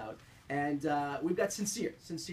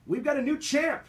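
higher, louder upbeat announcer read; radio tease; 8 semitones higher, 7 dB louder than usual. Acted. Radio host teases the next segment to listeners, upbeat. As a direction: sell what's coming up next.

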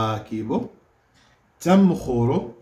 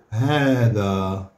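drawn-out teacher answer; slow, clear demonstration word; slow model answer; 2 semitones lower, much slower than usual. Shown as a demonstration. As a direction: say it slowly for the class to copy. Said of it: "شنو نقولوا؟".

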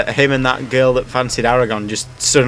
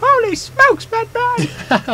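very high, squeaky sidekick voice; Robin voice; much slower than usual. Pretend. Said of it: "in that voice".

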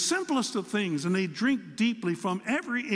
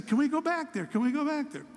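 put-on much higher, insistent child voice; pleading little grandson; 9 semitones higher than usual. Grandson pleading to go back to the maze, high insistent child voice. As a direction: nag like a small boy who wants more.

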